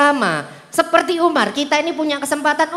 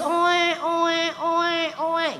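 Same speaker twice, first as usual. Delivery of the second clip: put-on baby cry; 5 semitones higher, 7 dB quieter, much slower than usual. Baby crying imitated.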